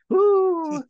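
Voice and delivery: high gleeful whoop; mock whoop. Eager cop cheers the coming shootout, high whoop. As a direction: whoop like a cop itching for a fight.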